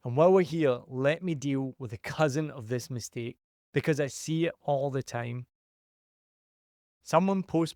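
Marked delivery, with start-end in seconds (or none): none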